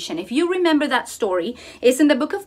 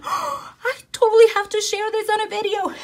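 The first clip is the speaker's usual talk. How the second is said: excited; 8 semitones higher than usual. Retold or imitated. Excited gasp at the snail.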